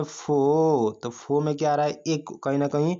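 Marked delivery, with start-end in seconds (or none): 0.0-1.0 फो held long on the vowel; isolated syllable. Shown as a demonstration. Teacher checking what students said, फो stretched out. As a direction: draw out the vowel of फो. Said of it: "क्या बोले".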